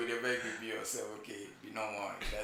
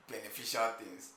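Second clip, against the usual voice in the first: normal talking voice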